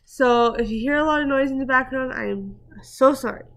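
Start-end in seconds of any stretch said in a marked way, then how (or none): 0.0-1.5 rushing louder as the dog goes wild; talking up over a barking dog, hurried. Real, not acted. Rushing to finish while her dog goes crazy, louder and hurried. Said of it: "My dog's going crazy, so I gotta go quick".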